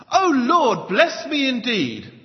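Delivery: booming, high showy plea to the Lord; loud praying. Acted. Imagined prayer guru overheard in his makeup room, loud acted plea. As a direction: belt out the prayer like a conference guru. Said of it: "you hear him pray".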